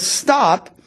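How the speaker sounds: slow reassurance. Retold or imitated. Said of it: "he says".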